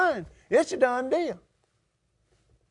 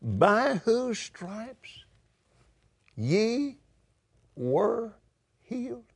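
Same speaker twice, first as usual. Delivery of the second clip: slow; 4 dB quieter, much slower than usual. Retold or imitated. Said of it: "what was it you said there".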